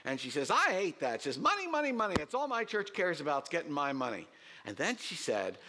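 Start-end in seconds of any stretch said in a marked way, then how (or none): 0.5-4.3 mock fed-up complaint, money said much higher and louder; half-hammed exasperated churchgoer; imitating angry woman. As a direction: act out her irritated rant. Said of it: "Oh, she was mad".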